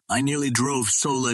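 usual speaking voice

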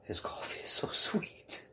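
unvoiced whispered aside. Real, not acted. Telling friends about the coffee in a whisper. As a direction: lean in and whisper it.

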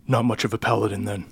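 lightly voiced villain, slightly low and soft; understated, quiet judgement; imposing interrogator. Villain sizes up the paladin, quietly imposing. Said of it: "and he goes".

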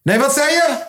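high-pitched strained asking; hard-of-hearing shouting. Pretend. Pretending to be deaf with a friend, high calling. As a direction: call out as if you cannot hear.

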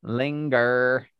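"Linger" said slowly with the "ling" drawn out; funny word Linger. Shown as a demonstration. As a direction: savour the odd sound of "Linger". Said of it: "syllable that jumps out as being said in a funny way".

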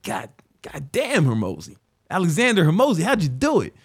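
astonished admiration; amazed. Retold or imitated. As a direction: gasp out his name in awe. Amazed at Hormozi's money.

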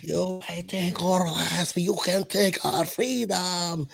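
slow, drawn-out bad Scottish accent; hammed-up Braveheart battle cry in botched Scottish; terrible Scottish accent. Host quoting Braveheart to Scottish fans, bad Scottish accent. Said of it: "I'm terrible at accents".

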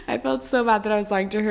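embarrassed giggly rush; laughing it out. Real, not acted. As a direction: laugh at your own guilt. Giggling through embarrassing confession.

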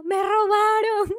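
loud, high drawn-out cry of alarm; re-enacted desperate yell. Traveller bursting into a mosque to report theft, desperate yell. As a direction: cry out for help, stretched and high. Retells running into mosque yelling.

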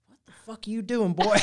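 high-pitched cop challenging a youngster; mock cop. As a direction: play an incredulous American cop through laughter. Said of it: "the cop's talking to the guy".

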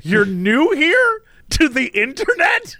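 high, disbelieving question; mock disbelief; incredulous. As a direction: sound amazed she is new to the internet. Incredulous retold reply.

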